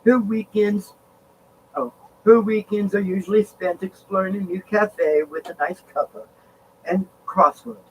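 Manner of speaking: a light, playful English accent with cuppa; a casual English accent on a nice cuppa. Shown as a demonstration. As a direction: read it like a chatty Englishwoman, lightly. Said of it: "I gotta put the accent on it".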